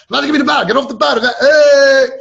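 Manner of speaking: loud, high mock yelling; hammed-up belligerent shout; drunken scene. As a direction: act out a drunk hollering over his bottle. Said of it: "Making such a scene".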